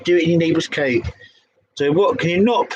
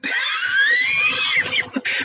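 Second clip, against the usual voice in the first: shrieking laugh. Real, not acted. Stuck on a long word, she shrieks with laughter.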